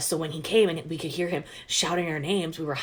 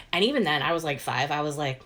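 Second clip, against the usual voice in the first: ordinary talk, nothing marked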